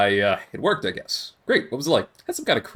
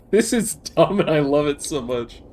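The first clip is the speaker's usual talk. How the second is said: laughing; slower than usual. Real, not acted. Laughs at the silly scene.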